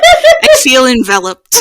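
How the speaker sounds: loud laughing mock swoon; husband through laughter. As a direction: blurt it out mid-laugh, teasing the husband. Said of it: "He's like".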